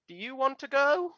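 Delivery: put-on high, rising child's question; small high-pitched kid voice. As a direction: ask it like a curious little kid. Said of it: "the children of there".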